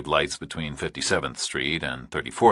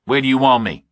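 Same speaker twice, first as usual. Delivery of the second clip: louder, higher, tense; tense Burke; 8 semitones higher, 7 dB louder, faster than usual. A narrator's reading. Burke answering the kidnapper, tense and louder. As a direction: push through nerves.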